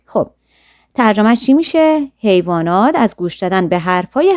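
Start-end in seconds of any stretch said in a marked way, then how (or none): none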